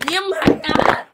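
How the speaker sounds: loud, high playful call. Real, not acted. Calls out to baby.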